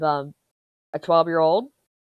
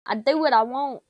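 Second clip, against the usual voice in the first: defiant; 8 semitones higher, 7 dB louder than usual. Real, not acted. Defiant punchline.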